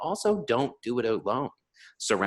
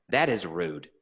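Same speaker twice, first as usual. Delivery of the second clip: ordinary delivery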